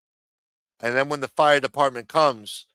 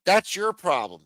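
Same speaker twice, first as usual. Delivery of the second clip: mocking, higher put-on voice of a fire chief; mocking quote; 8 semitones higher, 3 dB louder than usual. Ranting vlogger mocks a female fire chief, higher mocking voice. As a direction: sneer it out in a higher voice. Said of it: "Talking about".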